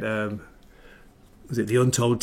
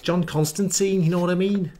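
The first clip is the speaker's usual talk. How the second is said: higher-pitched mock Liverpudlian accent; Scouse accent; 6 semitones higher than usual. Shown as a demonstration. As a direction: slip into an exaggerated Scouse lilt. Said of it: "Liverpudlian Scouse accent".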